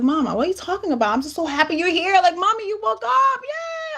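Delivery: gushing at mom waking up, pitch climbing; retold excited joy. Daughter retelling her joy at mom waking, excited squeal.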